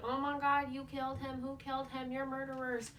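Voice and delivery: half-hearted retold yell; mock yelling. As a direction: act out his yelling, held back. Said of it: "yelling, he's like".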